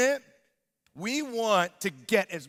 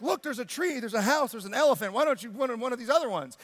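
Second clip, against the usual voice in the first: higher-pitched, eager persuasion; coaxing parent; 5 semitones higher, faster than usual. Retold or imitated. Dad offers upset son other cupcakes, coaxing. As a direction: coax a child toward another choice.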